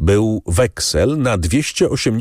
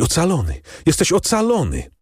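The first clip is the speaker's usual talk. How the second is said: higher-pitched, joyful exclamation; overjoyed cry; 7 semitones higher than usual. A narrator's reading. Daughter tells her father he is saved, overjoyed. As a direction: voice a daughter's rush of relief, pitch climbing. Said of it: "niemal nieprzytomna z radości".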